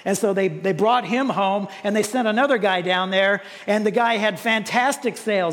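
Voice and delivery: usual speaking voice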